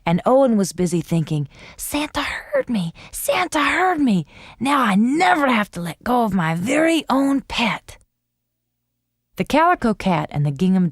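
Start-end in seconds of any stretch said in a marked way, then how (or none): 1.8-8.0 put-on high, delighted child; thrilled your wish came true; joyful. A narrator's reading. Boy's joyful thought.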